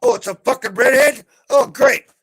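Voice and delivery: loud and high, fed up; loud exasperated outburst. Real, not acted. Hearing a redhead is in the basement, loud exasperation. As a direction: throw your hands up in exasperation.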